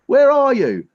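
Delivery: very loud, high searching call; screaming into a screen. Real, not acted. Comedian hunting for his unseen audience, loud high calling. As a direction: shout to find the missing audience. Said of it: "I'm just screaming".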